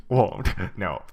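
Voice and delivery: ordinary delivery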